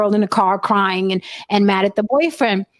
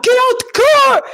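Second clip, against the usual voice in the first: shouted high and loud, retold; barked police order; 13 semitones higher, 5 dB louder than usual. Retelling cops ordering her out at gunpoint, loud shout. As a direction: yell it like a cop at gunpoint.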